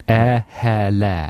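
posh accent sounded out word by word; mock posh accent, spaced words. Shown as a demonstration. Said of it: "the one that makes you sound posh".

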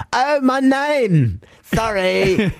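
high, drawn-out mock embarrassment; James realising. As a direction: squeal a put-on sheepish apology. Voices James realising his mistake.

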